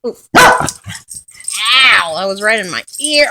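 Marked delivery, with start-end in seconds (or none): 0.4-2.1 loud, real "ow"; sudden cry of pain; pained yelp. Real, not acted. Dog noise hurts her ear.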